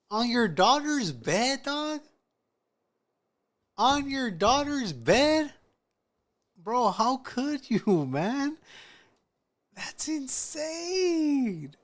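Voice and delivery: high, slow, drawn-out incredulity; scandalised disbelief. Real, not acted. Listener reacts to the dad's betrayal, scandalised. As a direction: wail each question up high in disbelief.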